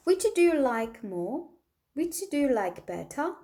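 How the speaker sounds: slow, clear question for learners; slow model question. Shown as a demonstration. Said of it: "뭘 더 좋아해요?".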